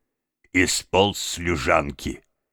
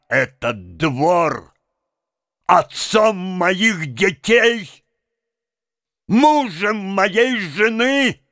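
high, slow, declaimed outcry; impassioned shouting; 12 semitones higher, 3 dB louder, slower than usual. A narrator's reading. Mendel rages about the yard that saw his life, impassioned shouting. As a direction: shout each phrase out in a frenzy. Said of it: "закричал он изо всех сил".

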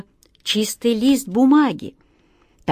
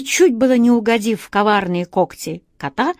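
plain reading voice

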